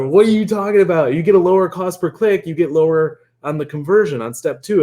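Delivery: mock an outraged commenter, pitch jumping up; freaking out. Group members argue back about cost per click, freaking out. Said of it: "people are freaking out".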